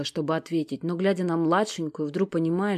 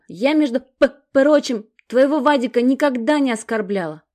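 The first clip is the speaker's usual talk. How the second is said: higher and louder, stammering in hurt protest; offended; 7 semitones higher, 9 dB louder than usual. Acted. Stezhka protests to Sasha, higher and hurt. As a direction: defend yourself through a stumble. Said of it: "обиделась Стежка".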